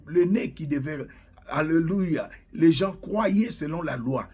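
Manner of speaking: ordinary delivery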